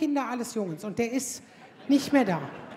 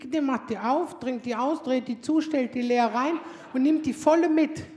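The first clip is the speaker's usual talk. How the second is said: ordinary talk, nothing marked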